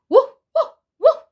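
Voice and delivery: high, loud yappy woofs; dog barks. Shown as a demonstration. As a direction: bark like a little dog. Reading animal sounds book.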